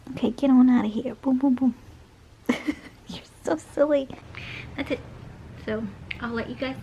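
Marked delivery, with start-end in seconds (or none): none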